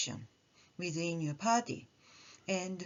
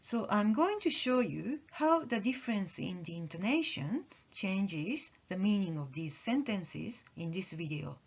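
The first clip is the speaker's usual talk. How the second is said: usual speaking voice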